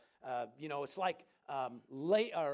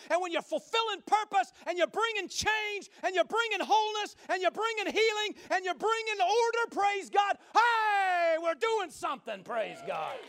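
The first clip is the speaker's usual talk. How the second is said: rising, loud and high, building to a shout; preaching; 11 semitones higher, 6 dB louder than usual. Acted. Preacher rallying his congregation, loud climbing preaching. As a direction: preach it out to the congregation, louder each line.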